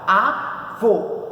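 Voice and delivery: Avô as two separate syllables, a, vô, with a gap; split into syllables. Shown as a demonstration. Teacher showing how to separate it, Avô syllable by syllable. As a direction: break Avô cleanly in two. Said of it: "como que se separa?".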